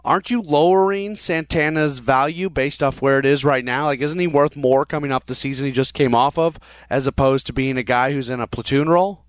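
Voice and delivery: ordinary talk, nothing marked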